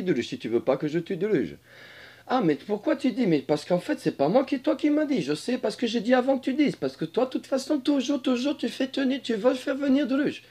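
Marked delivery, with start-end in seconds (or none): 2.3-10.4 high-pitched dragon ranting in circles; comic dragon character. Acted. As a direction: bicker in a high creature voice. Dragon figure argues in circles.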